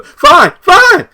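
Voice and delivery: a hammed-up sulky teen fine; high, loud mock pout; exasperated fine. [name] told to hold together.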